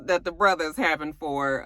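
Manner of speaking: ordinary talk, nothing marked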